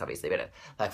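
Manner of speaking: normal talking voice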